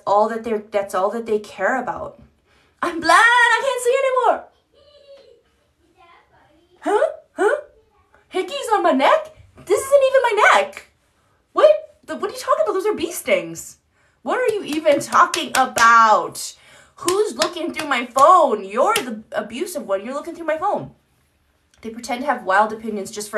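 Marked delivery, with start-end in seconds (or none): 2.8-4.5 high squeaky feigned-innocent voice, much higher and louder; mock narcissist denial. Mocks narcissist feigning blindness. 6.8-20.9 high falsetto outraged denial, much louder; hammed-up indignant gaslighting in a squeaky voice; mock narcissist denial. Mocks narcissist denying proof.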